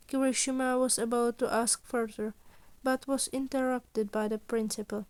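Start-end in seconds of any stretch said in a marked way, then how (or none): none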